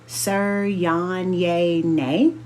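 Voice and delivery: drawn-out, halting "Sir Yan Ye Nay", sounded out syllable by syllable; slow mangled name. A narrator's reading. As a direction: stumble slowly through each syllable of a foreign name. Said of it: "she says, her eyebrows twitching as she attempts to sound it out".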